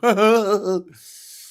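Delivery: big loud burst of laughter; cracking up at own joke. Real, not acted.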